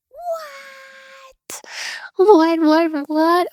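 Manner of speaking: high squeaky what, what, what; mock disbelief. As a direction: play shocked at your own news. Mock disbelief at own news.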